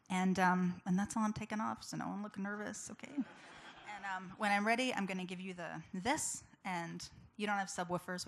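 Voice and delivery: normal talking voice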